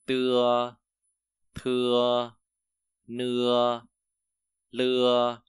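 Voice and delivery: loud, slow drill of Chinese initials with long pauses between syllables; loud, spaced-out pronunciation drill. Shown as a demonstration. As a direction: call each syllable out loud for the class to repeat. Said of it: "Ok, lại này".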